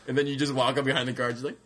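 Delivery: ordinary talk, nothing marked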